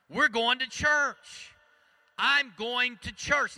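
slow, loud and high declaration; booming slow shout. Acted.

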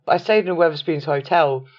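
ordinary talk, nothing marked

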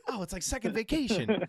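high, loud put-on squeal; mocking Tony. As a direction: squeal it like a giddy cartoon. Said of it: "Tony's like".

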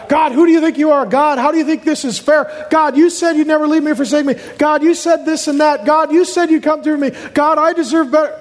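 high, fast-firing mock outrage at God; hammed-up aggrieved challenge; indignant questioning. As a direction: demand answers, each one pitched high. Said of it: "some people even do this with God".

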